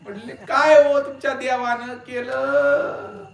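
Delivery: high, stretched wailing plea to the guru; loud mock wailing. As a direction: widow wails and begs the guru. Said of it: "मोठ्यानं रडायला सुरू केलं".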